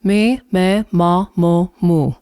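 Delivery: "Mi, me, ma, mo, mu" with the jaw dropped, round open vowels. Shown as a demonstration. Coach shows dropping the jaw on the vowels. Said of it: "Drop your jaw a little bit".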